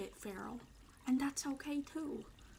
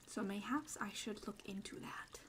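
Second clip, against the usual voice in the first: whisper; 4 semitones lower, 8 dB quieter than usual. Acted. Whispered aside.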